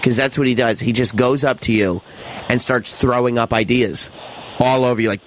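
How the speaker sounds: usual speaking voice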